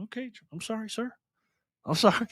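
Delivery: high, softened apologetic reply; mock meek apology. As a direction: back down sheepishly, small and high. Retold meek apology to offended donor.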